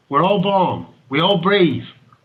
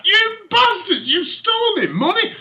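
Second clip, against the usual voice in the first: imitated outburst, much higher, slowed; mock angry yell at bank; slower than usual. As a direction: hurl it at the bankers, mock fury. Acting out yelling at bank.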